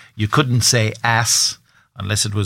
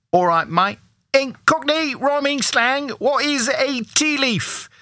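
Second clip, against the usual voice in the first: high-pitched put-on Cockney; hammed-up high Cockney voice; Cockney accent; 13 semitones higher than usual. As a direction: play a squeaky Cockney geezer asking a quiz question.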